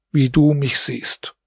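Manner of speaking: ordinary delivery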